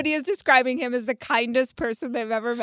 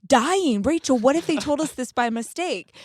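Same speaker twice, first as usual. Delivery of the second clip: anxious retold panic; 5 semitones higher, 4 dB louder than usual. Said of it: "And he's like".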